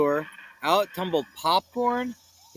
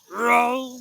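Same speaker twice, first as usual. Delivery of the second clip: Scooby voice; 6 semitones higher, 5 dB louder than usual. Acted. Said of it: "Scooby said".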